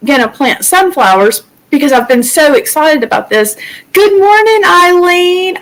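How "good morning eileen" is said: bright greeting. Real, not acted. Greeting viewers in live chat.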